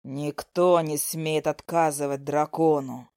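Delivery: low, slow dragon voice with contempt; menacing dragon. A narrator's reading. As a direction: loom over the foolish human child, drawing words out. Dragon speaks to heroine.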